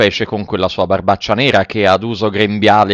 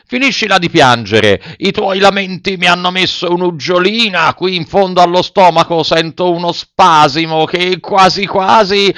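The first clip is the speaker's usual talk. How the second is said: raised voice of a grumpy puppeteer; high, complaining acted shout; gruff shouting; 7 semitones higher than usual. A narrator's reading. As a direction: scold him, building higher toward a sneeze. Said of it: "gridò a Pinocchio".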